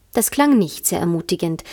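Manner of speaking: plain reading voice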